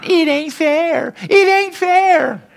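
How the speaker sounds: high, slow, drawn-out whine; whiny crying complaint. Retold or imitated. Preacher mocking believers who cry about unfairness, high slow whine. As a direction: whine it like a wronged child who wants pity. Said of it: "You don't have a right to be crying".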